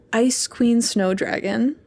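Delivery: usual speaking voice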